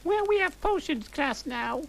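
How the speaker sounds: put-on very high chirpy goth girl; high girly Willow. Willow telling friends it's potions time, high voice. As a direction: pipe it up high and bright. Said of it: "Willow said".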